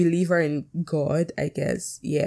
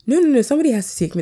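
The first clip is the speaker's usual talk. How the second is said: high, very fast string of objections; mock protest; 7 semitones higher, 3 dB louder, much faster than usual. Arguing in her head about getting to church, mock protest. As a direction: rush out an imitated stubborn refusal.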